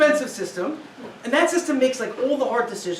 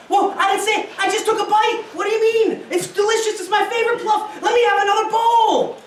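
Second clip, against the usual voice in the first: defensive husband; 7 semitones higher than usual. Acted. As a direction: insist it tastes great. Husband defensively praising dinner.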